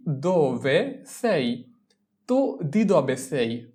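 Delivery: very slow, each word apart; slow drill repeat. Shown as a demonstration. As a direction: drill it slowly for learners. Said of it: "teacher repeats phrase slowly".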